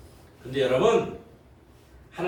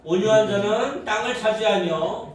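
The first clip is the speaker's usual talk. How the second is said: scripture recitation; 5 semitones higher, 4 dB louder than usual. Acted. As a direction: read the verse aloud together. Leads group scripture reading.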